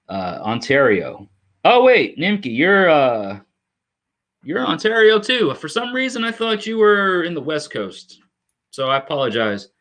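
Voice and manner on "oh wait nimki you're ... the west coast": sudden realization. Real, not acted. To viewer Nimki, realizing she's in Ontario, surprised. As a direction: catch your own mistake mid-sentence.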